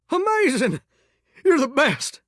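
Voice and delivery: high-pitched burst of thanks; delighted gushing. Acted. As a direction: gush with sudden delight. Thanks listener for agreeing.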